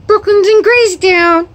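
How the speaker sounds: high, loud excited announcement about CRAZY TOWN; gleeful high-pitched pet talk. Real, not acted.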